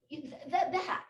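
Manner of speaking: high, loud indignant accusation; retold angry scolding. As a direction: act out an upset client telling someone off. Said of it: "she was so angry with me".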